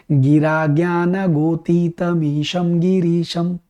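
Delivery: slow, measured chant of a Sanskrit hymn; hymn recitation. Shown as a demonstration. Teacher reciting the Shiva hymn to students, slow devotional chant. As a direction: intone each word of the prayer slowly. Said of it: "वो जो प्रार्थना शिव जी के लिए गाई जाती है".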